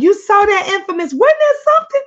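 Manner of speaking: high, loud, gleeful reaction; excited. Real, not acted. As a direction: squeal it out in disbelief.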